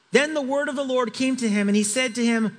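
ordinary talk

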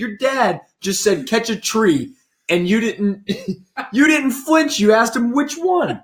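slow, high-pitched mock disbelief; incredulous. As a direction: spell it out as a baffled friend, drawn out. Acted incredulous friend recounting the catch.

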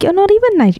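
high-pitched mock-indignant retort; retold in high-pitched disbelief; mock indignation. Mock outburst at a friend.

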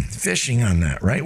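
normal talking voice

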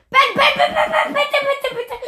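loud urgent calling; shouting a name. Real, not acted. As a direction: yell the name over and over. Begging teammate mid-play.

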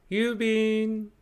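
a lightly voiced postman calling out; loud call. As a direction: sing out "郵便" at the door. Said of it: "大きな声がして".

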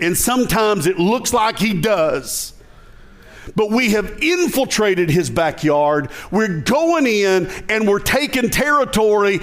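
high, driving preacher delivery; rally the church with rising fervor; impassioned preaching. Real, not acted. Pastor rallying church to take enemy territory, impassioned preaching.

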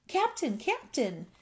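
mild call, high then falling. A narrator's reading. Bullfrog at the cabin door, calling out. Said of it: "he called".

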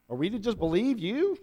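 mocking, doubting question; sarcastic. Crowd sneering at Jesus, sarcastic. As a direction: scoff at him, disbelieving. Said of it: "They're being sarcastic with him".

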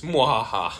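mock evil cackle; hammed-up villain laugh.

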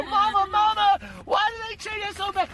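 put-on high, loud child's wail; hammed-up little girl crying out to mama; distressed little girl.

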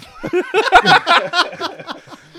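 a big, high burst of laughter; real loud laughter. Real, not acted.

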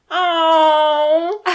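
loud, high, drawn-out "oh", half laughing; retold gushing swoon, high and loud; tearful aww. Fan retelling her reaction to her co-host, tearful aww. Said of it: "I openly said".

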